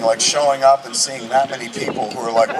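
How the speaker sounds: ordinary talk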